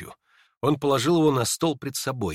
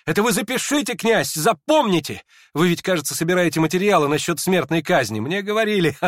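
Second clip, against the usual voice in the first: high, loud, insistent address; agitated raised-voice talk; excited urging; 6 semitones higher, 5 dB louder than usual. A narrator's reading. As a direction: press it on him eagerly. Hippolyte excitedly urges the prince.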